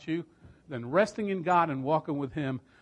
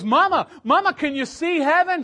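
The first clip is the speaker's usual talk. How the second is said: much higher, loud, pleading; eager high plea; 12 semitones higher, 8 dB louder than usual. Retold or imitated. Daughter begging her dying mother, loud and high.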